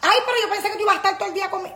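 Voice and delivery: high, fast pleading whine; whiny visitor. Retold or imitated. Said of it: "vienen aquí a mi casa".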